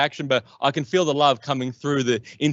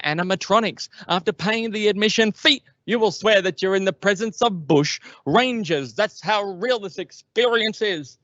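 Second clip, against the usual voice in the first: put-on pushy old salesman; 7 semitones higher than usual. Old counter guy pitching Ned Kelly attraction, high hammed-up barker voice. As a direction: sell it like an overexcited old carnival barker. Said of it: "this guy just starts going off".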